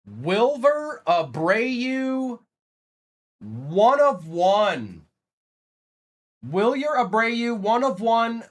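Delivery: high, slow, drawn-out card announcement; excited announcer. Acted. Streamer announcing a one of one autograph card to viewers, excited announcer. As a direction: stretch each name out like a big reveal.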